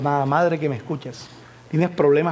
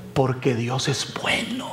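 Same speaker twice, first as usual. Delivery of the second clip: lento y suave, más bajo; tender; 4 semitones lower, 4 dB quieter, slower than usual. Acted. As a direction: deja caer cada palabra con ternura. Momento solemne y pausado. (Dios es bueno, dicho lento y tierno)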